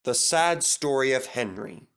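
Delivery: ordinary delivery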